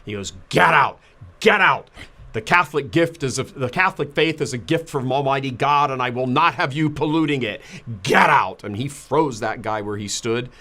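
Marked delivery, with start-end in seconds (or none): 0.5-8.6 much louder, higher furious shouting; furious scream. Retold or imitated. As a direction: bellow him out of the room. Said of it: "he just screamed at him".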